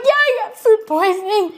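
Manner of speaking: high, hammed-up sobbing wail; mock crying. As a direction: wail it like a tearful confession. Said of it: "And I'm crying".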